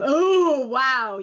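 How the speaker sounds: loud, high oh falling into wow; real burst of astonishment at a picture; startled amazement. Real, not acted. Reacts to a startling panel.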